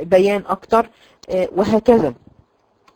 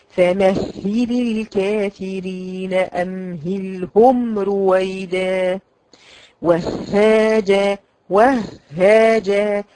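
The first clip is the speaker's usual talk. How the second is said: very slow Quranic recitation, doubled ha held; slow recitation demo; much slower than usual. Shown as a demonstration. Teacher reads slowly so the girls can follow. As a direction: recite فمهل الكافرين very slowly. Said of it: "أنا بقرأ طبعًا ببطء".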